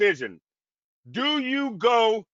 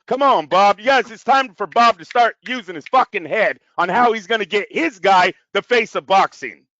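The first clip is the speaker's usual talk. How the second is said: raised, insistent voice; loud exhortation; 8 dB louder than usual. Real, not acted. Pundit loudly urging promoter Bob to act. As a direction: call out to Bob, push him hard.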